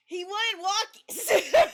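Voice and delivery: loud, high, slow through real laughter; laughing exclamation. Real, not acted. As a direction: burst into laughter as you say it. Cracking up about the cat.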